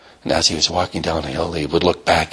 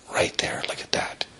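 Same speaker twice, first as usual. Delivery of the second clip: hushed awe; 9 dB quieter than usual. A narrator's reading. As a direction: whisper it as if showing a tiny treasure. Narrator marvels at tiny seed.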